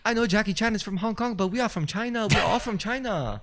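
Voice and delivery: high-pitched mock Chinese accent; hammed-up Chinese accent, voice way up. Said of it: "Chinese and Japanese accents there".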